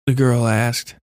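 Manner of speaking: usual speaking voice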